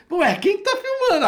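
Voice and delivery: high, puzzled mock question; puzzled onlooker. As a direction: play the baffled passerby.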